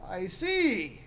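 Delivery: high, drawn-out formal voice; lilting servant, high and measured; gentleman servant. Acted. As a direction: usher them along politely. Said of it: "He says".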